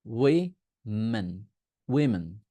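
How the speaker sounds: ওয়েমেন as wi... men, then উইমেন; wi-men, slowly split then joined; plural women. Shown as a demonstration. Teacher models the plural for students, "wi-men". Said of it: "তখন বলতে হবে ওয়েমেন".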